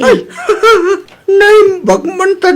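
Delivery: laughing. Acted. Husband moved by in-law's gift.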